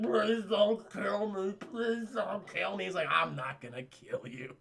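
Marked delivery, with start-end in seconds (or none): 0.0-2.9 slow, garbled mock pleading; paralyzed victim. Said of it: "he's all paralyzed". 3.1-4.6 low, slow, drawn-out answer; hammed-up deep-voiced refusal; mock menacing reply. Captor answers the pleading victim.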